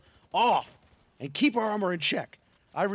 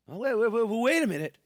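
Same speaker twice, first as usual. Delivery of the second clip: higher and louder sudden realization; mock surprise; 6 semitones higher, 5 dB louder than usual. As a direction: stop yourself, hammed-up discovery. Mock realization mid-explanation.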